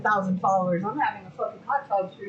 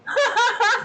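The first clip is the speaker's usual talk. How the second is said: laugh; 11 semitones higher, 4 dB louder than usual. Real, not acted. Laughing at chat.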